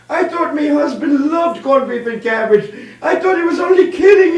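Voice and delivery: high mock wail in an Irish accent; keen like a comic widow; crying Irish widow. Irish widow at the group funeral, hammed-up high wailing. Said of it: "the three widows are crying".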